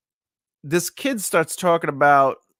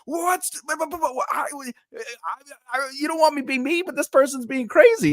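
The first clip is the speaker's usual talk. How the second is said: high, sputtering outburst, much higher; ready to explode; 12 semitones higher than usual. Retold or imitated. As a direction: stammer it out, barely holding in frustration. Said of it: "I'm, like, ready to explode".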